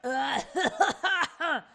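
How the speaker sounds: high, rippling put-on laugh; loud bursts of laughter in character; theatrical laugh. Acted laugh after the echo.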